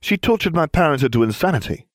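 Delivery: flatly. Acted. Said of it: "Neville said flatly".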